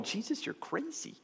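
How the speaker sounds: mocking crowd.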